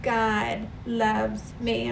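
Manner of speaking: slow, soft God loves me, stress on God; softly affirmed. Shown as a demonstration. Preacher modelling the words for the congregation, slow and soft. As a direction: speak it slowly as a tender affirmation. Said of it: "and just say".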